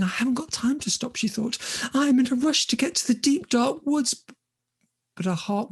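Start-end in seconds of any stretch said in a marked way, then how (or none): 0.0-1.2 put-on high, rushed young heroine; quick and high, no time to stop; flustered, higher-pitched hurry; hurried. Hurried Olga's thought, in story. 1.9-4.1 high, urgent voice of Olga; higher, anxious-to-get-going delivery; hurried heroine. Acted. Olga rushing to save brother.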